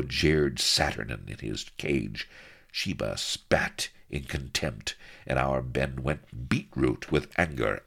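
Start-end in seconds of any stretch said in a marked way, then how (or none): none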